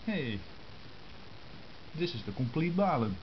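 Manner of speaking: normal talking voice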